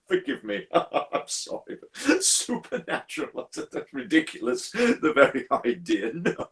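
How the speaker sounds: laughing through the words, high-pitched; amused disbelief. Acted. Visitor laughs off the signalman's supernatural question, laughing in disbelief. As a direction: break up laughing at an absurd idea.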